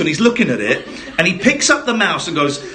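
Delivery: ordinary talk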